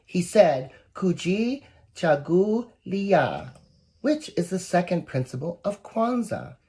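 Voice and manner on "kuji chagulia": "Kuji chagulia" spelled out slowly syllable by syllable; careful Swahili word. Shown as a demonstration. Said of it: "challenging for me to pronounce".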